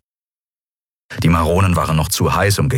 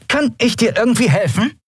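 mock helpful. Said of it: "fragte sie".

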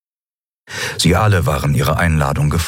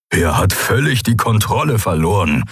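rumbling growl; 3 semitones higher than usual. A narrator's reading. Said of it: "grollte Skoru".

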